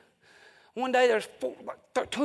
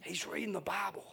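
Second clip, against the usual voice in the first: imitated quiet aside; 9 dB quieter than usual. Heckler's friend quietly correcting him, imitated hushed aside. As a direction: drop it right down after the outburst.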